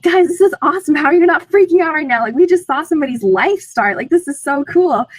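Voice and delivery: retold excited squeal; thrilled outburst. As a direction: gush at the friends, unable to contain it. Retelling her thrilled outburst.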